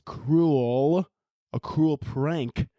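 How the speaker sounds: "cruel" said slowly and deliberately; careful pronunciation. Real, not acted. Podcaster retrying after tripping on it, "cruel" slow and careful. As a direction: draw out "cruel" and "prank" with care. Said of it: "Why the fuck can't I say that".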